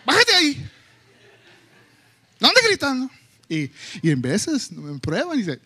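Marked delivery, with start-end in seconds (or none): 0.0-3.1 high, loud call across the house; yelling at the kids. Retold or imitated. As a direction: yell from the kitchen to the sofa. Parent yelling at kid on sofa.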